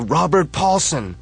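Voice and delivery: high, loud, slow chant of the name; crowd yell. Acted. Crowds chant the dead man's name, loud. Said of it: "the crowds yell".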